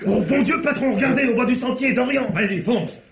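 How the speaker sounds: sudden alarmed call to the boss; excited alarm. Acted. Inspector spots Dorian fleeing and alerts the boss, urgent high outcry.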